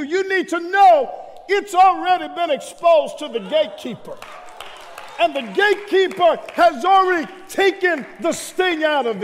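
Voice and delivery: loud, driving sermon delivery; preaching. Real, not acted. Pastor assuring the congregation the gatekeeper took the sting out, forceful preaching. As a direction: drive each phrase home to the congregation.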